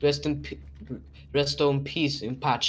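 put-on Italian accent. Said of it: "That was my Italian accent of rest in peace".

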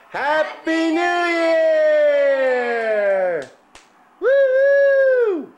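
high, loud midnight cheer; new year countdown crowd. Real, not acted. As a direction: whoop it out at midnight.

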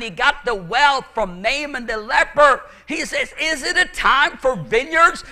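high, loud, impassioned sermon shout; full-force preaching. Real, not acted. Preacher calling brothers and sisters out of the world, full-force preaching. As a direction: cry it out to rouse the congregation.

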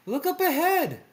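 put-on high-pitched excited girl character; Velma. A narrator's reading. Velma pointing out a stand, high and eager. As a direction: call it out way up high.

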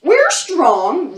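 loud, boastful croc roar. Acted. The crocodiles boasting to the monkeys, loud roar. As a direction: roar it out proudly. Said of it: "roared the crocs".

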